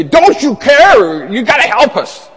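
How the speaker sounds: high, loud, frantic plea; panicked disciples. Acted. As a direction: cry out for help in a storm.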